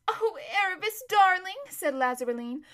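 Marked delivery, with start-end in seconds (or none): 0.1-1.6 high, swooping coaxing call; pleading. Acted. Lazareline pleading with her departing friend, high and coaxing. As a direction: plead sweetly, rising on the endearment.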